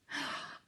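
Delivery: quick breathless gasp; soft gasp. Acted. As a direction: gasp in mock alarm. Story turns, reader gasps.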